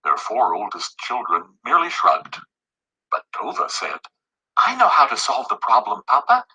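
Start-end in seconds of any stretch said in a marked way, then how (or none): 4.6-6.4 put-on higher child voice, eager; a bright child with a plan; lightly voiced little girl. A narrator's reading. Read to children: daughter explains her plan, lightly childlike.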